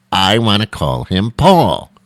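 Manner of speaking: escalating name argument; insistent parent. Retold or imitated. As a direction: drag it out stubbornly. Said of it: "couldn't make a decision".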